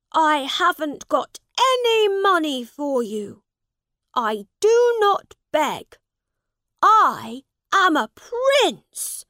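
high, loud, indignant refusal; defiant prince. A narrator's reading. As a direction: refuse haughtily at full voice. Prince defies the father demanding money.

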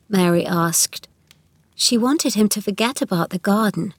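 ordinary delivery

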